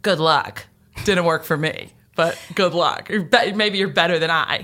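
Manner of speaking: pat her on the head with it; a hint of a dismissive skeptic; condescending brush-off. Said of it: "kinda pat on the head".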